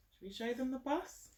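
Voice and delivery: usual speaking voice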